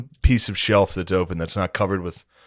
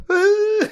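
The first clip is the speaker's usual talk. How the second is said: very high pretend bawl; sudden squealed fake cry; fake crying wail. Shown as a demonstration. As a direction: break into a mock baby howl. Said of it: "You can trick babies into not crying".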